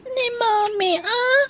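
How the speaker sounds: slow, crying confession; tearful. Acted. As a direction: sob out the painful truth. Child confesses mother pinched her.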